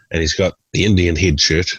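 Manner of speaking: normal talking voice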